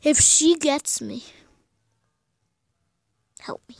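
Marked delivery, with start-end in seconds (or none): 3.4-3.8 barely-there whisper for help; tiny frightened whisper; scared whisper. Real, not acted. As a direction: breathe the words out in fear. Hiding, scared.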